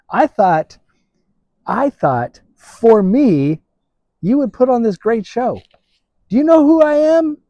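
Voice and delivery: high, indignant voice of a proud commander; arrogant Naaman. Acted. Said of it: "that's really what Naaman is saying".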